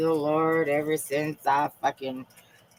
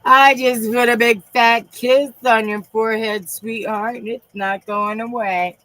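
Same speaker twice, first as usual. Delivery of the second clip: higher, lightly put-on affectionate voice; a hint of a doting God; voicing God; 9 semitones higher, 6 dB louder than usual. God speaking tenderly to her, in a lightly voiced higher tone. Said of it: "He said".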